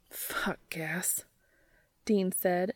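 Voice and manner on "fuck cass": hushed desperate whisper. A narrator's reading. Dean aching for Cass on the phone, desperate whisper. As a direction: let it slip out in a desperate whisper. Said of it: "an edge of desperation in his voice".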